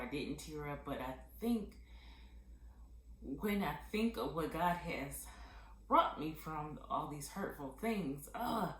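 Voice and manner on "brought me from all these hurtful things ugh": ordinary delivery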